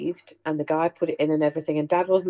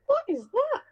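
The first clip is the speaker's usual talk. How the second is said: very high startled question; mock bafflement; 3 dB quieter than usual. Hearing the lawnmower roar from the kitchen, mock surprise. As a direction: squeak it out puzzled. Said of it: "we're looking at each other like".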